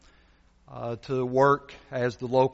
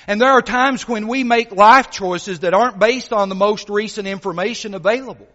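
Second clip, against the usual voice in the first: lively, louder preaching voice, storytelling to the pews; sermon delivery; 7 semitones higher, 9 dB louder than usual. Acted. Preacher telling the congregation a story, lively preaching. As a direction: preach it with energy, painting the scene.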